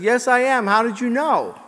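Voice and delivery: high, loud joke-character reply; calling back up. Acted. Walker calls back up to parachutist.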